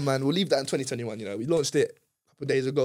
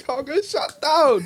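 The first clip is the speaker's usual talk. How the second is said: high, slow mock triumph; gloating; 13 semitones higher, 6 dB louder, much slower than usual. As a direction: drag it out, high and proud. Gloating about club closure.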